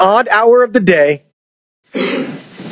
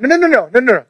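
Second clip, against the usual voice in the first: higher, quick run of no's; hammed-up childish refusal; mock protest; 5 semitones higher, much faster than usual. Voicing the sinner's refusal.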